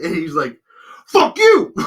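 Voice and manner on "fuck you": very high, loud angry shout; imitated furious outburst; retold yell. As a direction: bellow back as the enraged man. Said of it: "he just yells".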